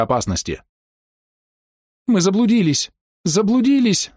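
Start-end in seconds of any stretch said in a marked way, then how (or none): 2.1-4.1 very high, panicked cry; terrified scream. A narrator's reading. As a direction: scream it in terror, much higher. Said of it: "закричала Ида".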